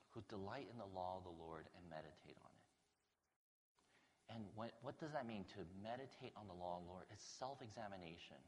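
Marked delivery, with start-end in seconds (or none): 0.1-4.3 slow, soft repeat. Shown as a demonstration. Preacher repeating a verse slowly and softly to the congregation. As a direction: say "Who delight in the law of the Lord" slowly and softer.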